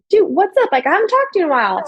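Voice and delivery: high, quick, friendly catch-up; retold cheery phone hello; upbeat greeting. Sister catching up with her little brother, upbeat.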